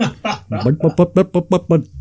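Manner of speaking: babbling in an exaggerated Indian accent; mock Indian accent. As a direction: imitate Indian shopkeeper chatter. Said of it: "They do a great Indian accent".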